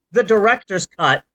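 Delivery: ordinary talk, nothing marked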